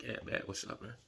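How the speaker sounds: retelling his own hushed answer in a whisper; imitated whisper. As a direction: whisper the reply back. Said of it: "I'm like".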